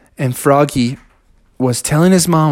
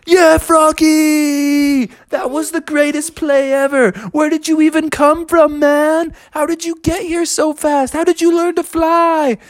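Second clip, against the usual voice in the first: put-on kids going wild for the goal; cheering friends; 10 semitones higher than usual. Hockey friends cheer Froggy.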